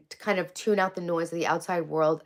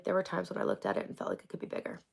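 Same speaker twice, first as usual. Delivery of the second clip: confessional whisper; 8 dB quieter, faster than usual. Real, not acted.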